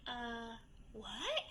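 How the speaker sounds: very soft, stunned mumble; retold shock. Man caught out about the paper, quietly shocked. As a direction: imitate someone shocked nearly speechless. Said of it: "he's just shocked".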